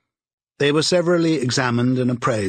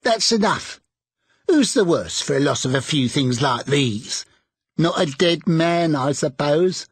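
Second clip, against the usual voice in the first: defiant charwoman; 4 semitones higher than usual. A narrator's reading. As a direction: toss it out boldly.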